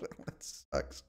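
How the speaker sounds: breathy whispered mutter, almost no voice. Real, not acted. Streamer ruined by Lithuania, whispering to himself. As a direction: breathe it out under your breath.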